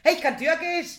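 high, loud burst of delight; real triumphant excitement. Real, not acted. Learner celebrating to co-host after nailing a toast, excited shout.

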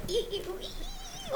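high slow squeals of panic; mock panicked squeal. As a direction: squeal like a girl chased by a fly. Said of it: "She's like".

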